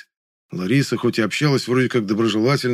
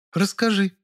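high, eager female demand; demanding woman; 11 semitones higher than usual. A narrator's reading. Zina wants the story, demanding. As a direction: insist in a raised feminine pitch. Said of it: "потребовала Зина".

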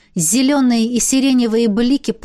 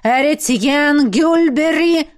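slow, higher-pitched summons; insistent incantation; 5 semitones higher, 3 dB louder, slower than usual. A narrator's reading. Necromancer calling the dead by name, insistent incantation. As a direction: draw the name out as a spell.

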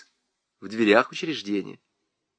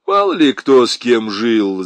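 louder, offhand musing; philosophical; 7 dB louder than usual. A narrator's reading. Lieutenant brushes off Nyura's claim, philosophizing. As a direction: toss it off as a worldly generality. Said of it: "заметил лейтенант философски".

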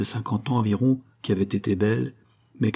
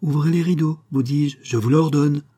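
higher, emphatic command; firm order; 5 semitones higher, 4 dB louder than usual. A narrator's reading. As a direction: insist firmly, voice raised. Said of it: "répondit le docteur avec fermeté".

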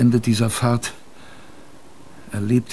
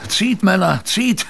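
urging cry; 8 semitones higher than usual. Acted. Urging rowers on.

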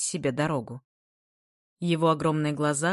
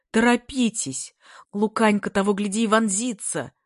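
high, loud hurrying shout; urgent calling; 5 semitones higher, 5 dB louder than usual. A narrator's reading. Classmates urge haste before the teacher arrives, urgent. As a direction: hurry them along. Crowd urges the speaker on.

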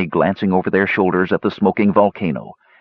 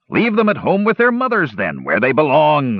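gruff dwarf, pitch raised; 8 semitones higher than usual. A narrator's reading. Dugan to warriors about their children, gruff. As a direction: grumble it as blunt advice, starting high. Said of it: "said Dugan gruffly".

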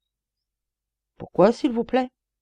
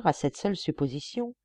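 ordinary delivery

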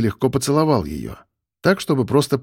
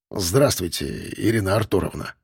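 guilty smile; 5 semitones lower, 4 dB quieter than usual. A narrator's reading. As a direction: apologise with a sheepish smile. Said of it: "виновато улыбнулся тот".

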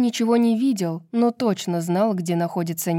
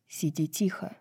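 hushed, much softer command; whispered order; 2 semitones lower, 8 dB quieter than usual. A narrator's reading. Azriel orders Bryce to sit quietly, whispering. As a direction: drop to a hushed warning. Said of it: "прошептал Азриэль".

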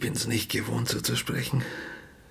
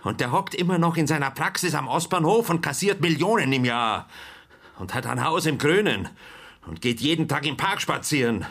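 higher, indignant rant; bitter outrage; 7 semitones higher than usual. Acted. Father rails at the inspector about the dentist, bitter outrage. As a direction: spit out how well he lives.

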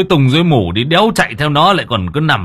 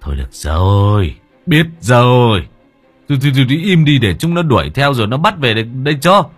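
impatient, faster hushing, a touch louder; mild exasperation; 5 semitones higher, 5 dB louder than usual. Acted. Village chief hushes the crying mother, mildly exasperated. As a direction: wave her off, tired and irritated.

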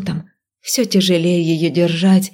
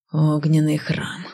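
slow quiet hiss; 3 semitones lower, 5 dB quieter, slower than usual. A narrator's reading. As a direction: hiss it out slowly, under breath. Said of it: "прошипел некромант". (прошипел)

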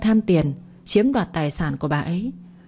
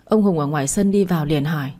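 usual speaking voice

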